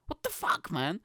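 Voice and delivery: breathy mock squeal. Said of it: "they're like the Tinder girl".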